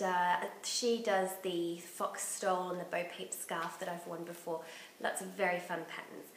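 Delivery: normal talking voice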